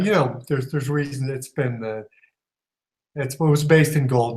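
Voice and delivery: ordinary talk, nothing marked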